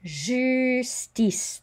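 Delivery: "Justice" as zhüs-tees, no final E; teacher models "Justice" high and careful; French model word. Shown as a demonstration. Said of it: "the J is a Z sound".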